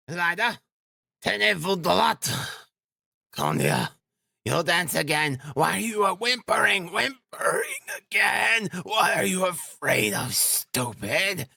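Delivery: high, sharp, frantic reprimand; exasperated scolding. A narrator's reading. Mother scolding her whimpering children, exasperated.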